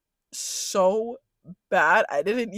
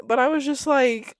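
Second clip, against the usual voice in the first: ordinary delivery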